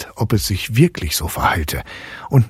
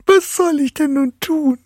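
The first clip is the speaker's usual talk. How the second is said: put-on more desperate girl. A narrator's reading.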